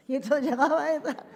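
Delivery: laughing out the words; can't hold the laugh back. Real, not acted. Telling the interviewer about Edgar, laughing. Amused memory of Edgar arriving.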